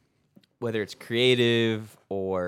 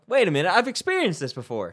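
surprised realization; 8 semitones higher, 5 dB louder than usual. Retold or imitated. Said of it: "I'm like".

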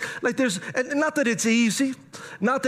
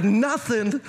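incredulous echo. Acted. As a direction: throw the word back in disbelief.

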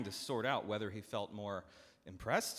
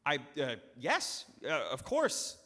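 higher, louder, stunned delight; joyful stammer; 5 semitones higher, 6 dB louder, slower than usual. Acted. Farmer stunned by debt relief, stammering with joy. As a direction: stumble over words for joy. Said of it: "he responded with joy".

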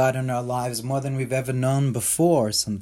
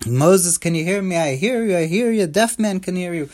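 higher, comic booming God from the movie, lightly done; Mel Brooks God voice; 5 semitones higher than usual. Retold or imitated. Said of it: "like Mel Brooks says".

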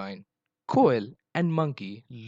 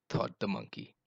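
ordinary delivery, nothing marked

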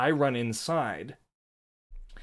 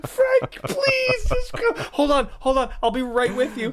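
much higher and louder, desperate pleading; panicked pleading; 5 dB louder than usual. Retold or imitated. Owner chasing her escaped dog, panicked high pleading. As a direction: beg the dog to come back, near tears. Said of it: "on the verge of tears".

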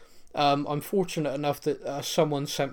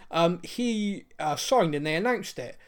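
ordinary delivery

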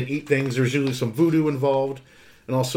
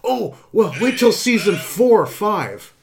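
slow, higher, mimicking fan advice; mocking fan voice; 7 semitones higher, 3 dB louder, slower than usual. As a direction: imitate a know-it-all fan. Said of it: "it's like, Oh".

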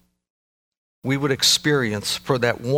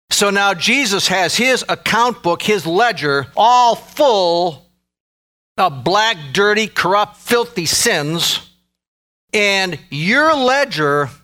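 loud, raised-pitch pulpit delivery; impassioned preaching; 6 semitones higher, 5 dB louder than usual. Real, not acted. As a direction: drive each phrase home to the congregation. Preaches at full force.

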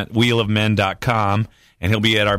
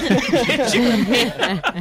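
slow, high, through laughter; laughing; 11 semitones higher, much slower than usual. Real, not acted. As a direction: stretch it out while laughing.

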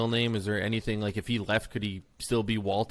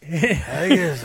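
haggis in a hammed-up Scottish accent, higher and louder; 4 semitones higher, 6 dB louder than usual. Cohost imitates a Scottish voice asking about haggis in a Scottish accent. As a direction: roll out haggis like a Scot. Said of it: "Scotland's like".

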